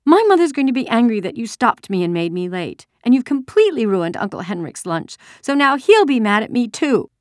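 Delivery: put-on high, loud upset child's complaint; tearful. A narrator's reading. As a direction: protest as a girl in tears. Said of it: "she began to cry".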